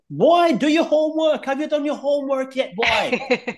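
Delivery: high-pitched mock scolding in a Caribbean accent; imitated Caribbean mum. Mum off the phone to her child about homework, high Caribbean-accented scolding. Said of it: "she'd come off the phone and say".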